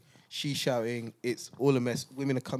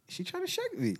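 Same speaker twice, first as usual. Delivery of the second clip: much higher, disbelieving squeak; high incredulous question. Retold or imitated. Baffled by nurse jabbing a needle, high incredulous squeak.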